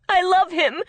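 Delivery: high, loud defiant protest; tearful anger, quick and loud; suddenly angry. A narrator's reading. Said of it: "She was suddenly angry at her sister".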